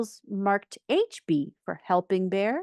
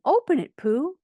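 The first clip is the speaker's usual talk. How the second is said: high, loud clamour; eager urging; 9 semitones higher, 6 dB louder than usual. A narrator's reading. Party guests pressing Pooh to open his present, high and eager. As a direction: urge excitedly.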